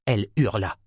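ordinary delivery, nothing marked

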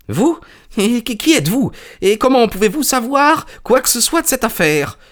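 very high, stammering questions; alarmed little man. Acted. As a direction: blurt out startled questions up high. Frightened little man questions stranger.

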